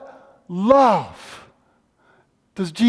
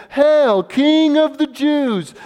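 mocking hail; 8 semitones higher, slower than usual. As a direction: sneer it like a jeering soldier. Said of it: "and they mocked him, saying".

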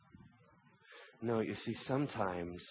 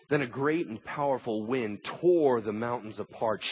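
ordinary delivery

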